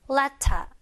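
"letter" as "let-tah", t sounded; British pronunciation. Shown as a demonstration. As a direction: keep the t and drop the r in "letter". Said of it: "이렇게 발음이 됩니다".

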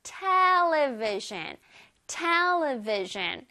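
television with a strong TE at the front; drawn-out television, the opening TE stressed; stress on first syllable. Shown as a demonstration. Teacher drills children on television, stressing the first syllable. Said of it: "앞에 T E의 강세가 들어가요".

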